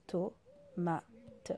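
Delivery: Tomate dit doucement; blending the word. Shown as a demonstration. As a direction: assembler le mot tout bas. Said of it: "O, to". (mot tomate lu doucement)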